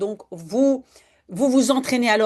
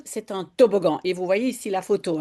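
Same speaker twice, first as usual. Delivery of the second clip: ordinary delivery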